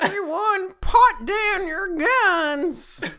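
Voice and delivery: high, slow and deliberate; commanding fairy voice. Acted. Cirno calling for peace in the fanfic in a high, slow voice. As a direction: announce it like a grand decree. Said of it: "Cherno said".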